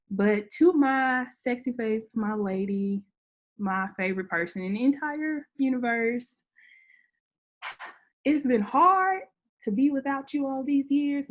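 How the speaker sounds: fighting back tears while saying goodbye; a real, emotional goodbye, pitch climbing on hard; tearful farewell. Real, not acted. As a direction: voice rising and catching as she speaks to her late grandmother. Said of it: "hearing you say goodbye to your family members".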